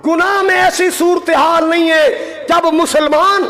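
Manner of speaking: impassioned, ringing proclamation; fervent preaching. Real, not acted. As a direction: drive it out to the congregation like a preacher. Preaches at full force.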